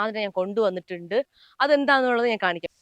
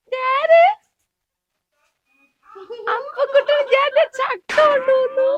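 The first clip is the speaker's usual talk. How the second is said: very high, loud, drawn-out singsong; slow, squeaky-high baby-talk greeting; playful child-directed voice; 14 semitones higher, 7 dB louder, slower than usual. Pretend. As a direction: coo it loudly to a little kid, way up high.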